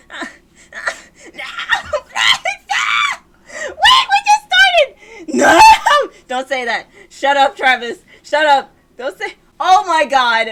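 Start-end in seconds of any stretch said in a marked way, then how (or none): 0.1-5.6 high, loud wail of protest; anguished pleading scream. Real, not acted. Player begging the game not to do this, high screamed plea. 7.1-10.5 exasperated loud outburst; loud scolding protest. Real, not acted. Player telling Travis to stop talking, loud upset protest.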